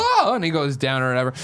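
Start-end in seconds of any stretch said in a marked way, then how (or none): 0.0-0.3 high, loud cry of pain; mock pained yelp. Miles yelps when shot in the shoulder, high and loud. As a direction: yelp like you got shot. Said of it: "He's like".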